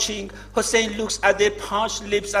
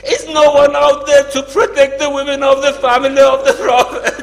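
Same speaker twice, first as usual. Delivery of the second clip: loud, high call to the army; desperate shout; 3 semitones higher, 6 dB louder than usual. A narrator's reading. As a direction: cry out across the battlefield. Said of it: "stands in front of the army and shouts".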